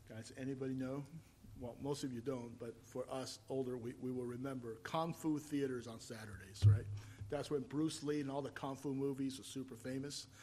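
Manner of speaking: normal talking voice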